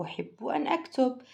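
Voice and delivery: normal talking voice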